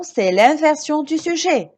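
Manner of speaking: usual speaking voice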